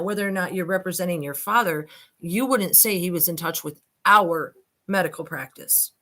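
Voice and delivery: ordinary talk, nothing marked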